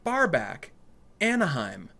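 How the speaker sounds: high mock announcer read; a light mock of a recorded job listing; recorded message. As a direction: read it like a cheesy phone ad. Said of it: "the next one was like".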